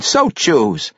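high, loud and jovial; hearty amusement. A narrator's reading. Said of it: "Pericolo laughed heartily".

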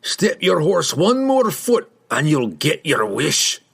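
slow dwarf threat, starting high; warning dwarf. A narrator's reading. Dwarf warns rider not to advance, slow menace. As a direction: warn them off, word by word. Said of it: "Bruenor warned".

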